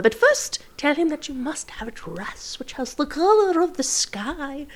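high, gentle fairy godmother voice; kindly old godmother; old lady voice. Acted. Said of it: "your old lady voice is my favorite thing ever".

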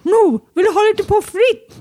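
high, slow sing-song coaxing; high coaxing voice to a child; coaxing parent. Retold or imitated. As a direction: sweeten it to tempt a child.

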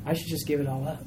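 soft, resigned self-doubt; defeated inner voice. Retold or imitated. Believer hearing Satan's lie, quietly defeated. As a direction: murmur it like giving up. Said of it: "He wants us to think".

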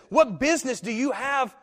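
high, loud cry; shouting demoniac. A narrator's reading. Possessed man shouting at Jesus, loud and high. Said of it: "shouting with a loud voice".

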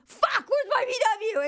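sudden high-pitched dismay; high panicked realization. Retold or imitated. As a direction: gasp it out in shock. Realizing wrong car.

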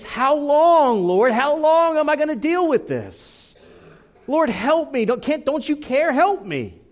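desperate plea, voice strained high; pleading lament. Acted. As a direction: cry out in anguish, loud and high. Preacher voices David's lament.

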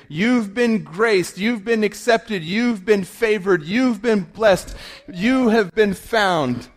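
high, slow, emphatic announcement; angel's proclamation. Acted. Angel tells Mary she is favored, high proclamation.

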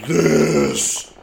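huge loud stone boom. A narrator's reading. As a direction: boom it out like a giant. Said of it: "it boomed".

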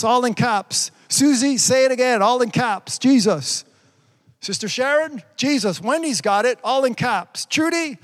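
high, emphatic calling out; rousing preacher. Acted. Preacher urging viewers to type Jesus in caps, rousing call.

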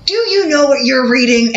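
high, loud furious challenge; angry confrontation. Retold or imitated. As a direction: confront the stranger, voice rising hard. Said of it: "I go".

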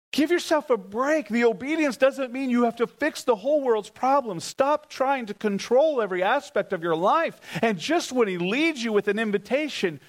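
high, pleading preacher's call; fervent preaching. Acted. Preacher urging the congregation to stop carrying everything, fervent and high. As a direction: lift them up and release them from the burden.

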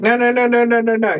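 high, loud, rapid string of protests; panicked refusal. Retold or imitated. Child told a tarantula goes on her leg, panicked refusal. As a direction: reenact frantic pleading in one unbroken run.

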